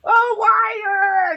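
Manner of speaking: high, hammed-up cry of regret; mock anguished wail. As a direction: wail "why" like a soul in torment. Acting out self-blame lament.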